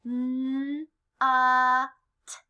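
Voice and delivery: "N, uh, t" sounded out one sound at a time; slow phonics segmenting of "N, uh, t"; stretched-out sounds. Shown as a demonstration. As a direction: hold each sound of "N, uh, t" apart. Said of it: "Now stretch it out".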